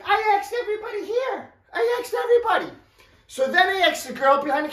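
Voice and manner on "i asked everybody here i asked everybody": much higher, pleading defence; exasperated protest. Retold or imitated. Narrator defends himself to the woman, high exasperated pitch. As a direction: squeak out that you did ask.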